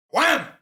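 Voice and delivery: sudden high loud wham; loud imitated crash of a glass; crash noise. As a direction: hit it like a glass smashing. Glass thrown at him.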